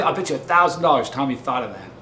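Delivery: ordinary talk, nothing marked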